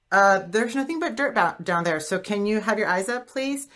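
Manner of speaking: mock-exasperated call to look up; trainer calling out. Trainer to clients staring at the floor, calling out.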